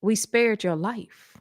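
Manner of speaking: slow, measured answer; lightly voiced solemn God. God replies to her.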